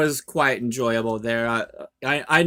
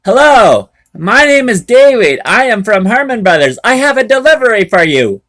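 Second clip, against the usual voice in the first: loud, higher, formal check-in; proper trucker check-in; 7 semitones higher, 8 dB louder than usual. Shown as a demonstration. A driver shows the right intercom greeting, loud and formal. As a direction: announce yourself properly at the gate. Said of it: "You're supposed to tell them".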